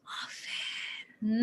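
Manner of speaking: whispered often, drawn out. Shown as a demonstration. Teacher modelling a whispered reading of often. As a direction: say often on breath alone. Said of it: "read it slowly".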